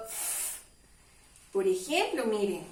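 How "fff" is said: "F" as a long, voiceless fff; sonido F. Shown as a demonstration. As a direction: hold the bare sound of "F", not its letter name. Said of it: "que contienen el sonido F". ("F" como sonido sostenido: fff)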